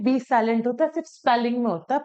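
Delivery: usual speaking voice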